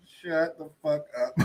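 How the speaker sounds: ordinary talk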